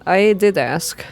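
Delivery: usual speaking voice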